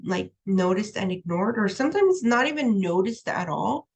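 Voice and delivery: ordinary delivery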